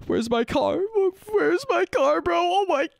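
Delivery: gasp, then frantic high cries of disbelief; panicked disbelief. Retold or imitated. To himself on finding his car stolen, panicked high cries. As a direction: relive the panic of an empty parking spot.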